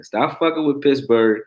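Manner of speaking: ordinary talk, nothing marked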